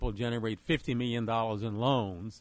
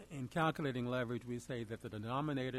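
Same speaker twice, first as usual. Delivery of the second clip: softly spoken, hard to hear; too quiet; 6 dB quieter than usual. Real, not acted. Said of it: "can you speak up a little bit".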